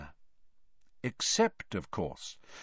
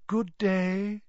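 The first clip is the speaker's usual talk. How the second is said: airy, high and dreamy; misty voice; 9 semitones higher than usual. A narrator's reading. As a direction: float the greeting mistily. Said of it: "the misty voice of Professor Trelawney".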